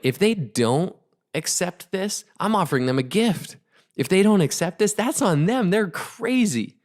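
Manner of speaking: high, emphatic disbelief, imitating her certainty; imitated indignant certainty, stretching the last words; incredulous conviction. Voices partner's conviction about her offer.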